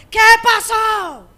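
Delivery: high, loud, drawn-out yell back; shouted reply. Retold or imitated. Son answering his mother's yell, shouted reply. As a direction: imitate a son hollering back across the house. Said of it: "él me escuchaba y me decía".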